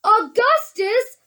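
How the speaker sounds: high, drawn-out shout; crying out. A narrator's reading. Wonka crying to Augustus, high and drawn out. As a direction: cry the name out long and high. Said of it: "cried Mister Wonka".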